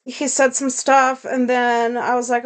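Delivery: usual speaking voice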